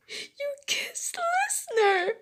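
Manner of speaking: very high, drawn-out sobbing wail; crying yell. Acted. Jealous girl to boy, yelling and sobbing. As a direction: wail the accusation tearfully. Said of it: "crying and sobbing".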